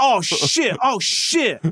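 high, loud mock-panicked cries; retold alarm. Dentist panicking midway through the procedure, mock alarm. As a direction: imitate mounting alarm, pitch climbing on the repeat.

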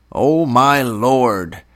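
slow, loud, drawn-out wail; affectionate wail. A narrator's reading. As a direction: wail in fond despair at a pal. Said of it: "Tub wailed affectionately".

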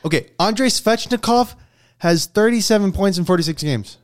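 high-pitched, insistent counterargument; worked-up sports debate; heated rebuttal. Real, not acted. Disputing co-host's all-star pick.

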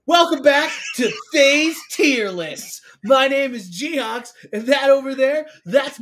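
YouTube voice. Pretend. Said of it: "like, a YouTube voice".

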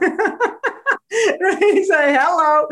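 high burst of laughter on right; laughing. Real, not acted. Guest laughing about her book title.

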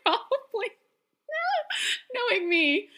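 laughing. Real, not acted. Laughing at her own clumsiness.

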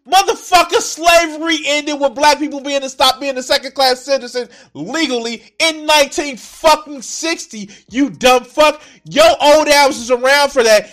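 loud, high, angry yelling; furious shout. Real, not acted. Streamer shouting back at the man on screen, furious. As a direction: tear into him at full volume.

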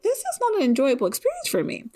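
high-pitched, hurried self-quote; whiny-high inner protest, played for comedy; mock complaint. Scared viewer protesting to herself about the drama, mock complaint.

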